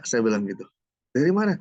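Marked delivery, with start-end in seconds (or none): 1.1-1.5 high, louder retold question; wife's questioning. Wife asks husband where it comes from, questioning. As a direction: imitate a wife pressing her husband.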